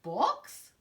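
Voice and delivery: pergunta confusa, mais aguda; pirata confuso. Acted. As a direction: estranhe a palavra. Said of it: "Braid Bear looked confused".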